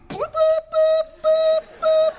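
high bleeps standing in for curse words; censor bleep noises. Retold or imitated. Bleeping out the ex-president cussing on the plane, high squeaky beeps. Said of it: "he starts cussing him out".

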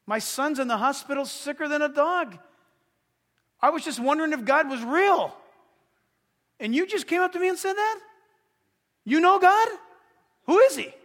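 high, loud, amazed questions; stunned excited stranger. Retold or imitated. Stranger with a sick son amazed at the message, loud and high. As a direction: blurt it out astonished. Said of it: "that guy goes".

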